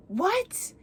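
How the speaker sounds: high-pitched incredulous what; shocked question. Acted. Taryn hears Story's confession, shocked question. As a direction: jump up high in disbelief.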